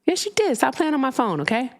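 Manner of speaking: high-pitched, exasperated retort; exasperated squabbling. Real, not acted. Back-and-forth squabble with prank caller.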